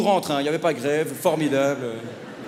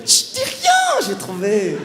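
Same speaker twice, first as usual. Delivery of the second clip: eager guesser; much slower than usual. Retold or imitated. Guessing player silences the comedian to work out his initials, hammed-up excitement. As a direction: play an over-keen guesser squealing for silence.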